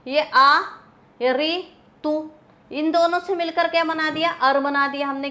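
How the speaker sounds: ordinary delivery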